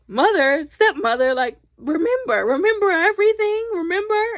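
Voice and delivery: loud, high retold plea; acted-out desperate call; urgent pleading. Retold daughter pleading with stepmother.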